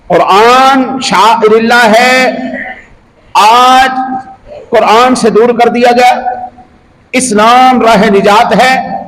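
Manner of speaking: high-pitched, measured sermon delivery; impassioned preaching. Real, not acted. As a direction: preach it in ringing phrases with drawn-out key words. Preaches to the congregation.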